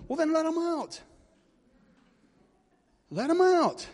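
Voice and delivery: preacher's high, sustained plea; high exhortation. Real, not acted. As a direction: stretch it out high, urging the listeners. Preacher cries out to congregation.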